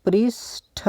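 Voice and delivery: पृष्ठ drawn out with aspirated ठ; पृष्ठ stretched, a gap before the ष्ठ; sounded out slowly. Shown as a demonstration. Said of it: "पृष्ठ होता है, यानी कि".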